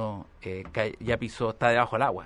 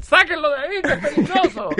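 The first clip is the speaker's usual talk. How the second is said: higher, louder panicked yell, laughing; mock alarmed shout; 7 dB louder than usual. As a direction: shout to get him off the horse. Y empiezan a gritar. (grito alarmado de burla, agudo y fuerte, entre risas)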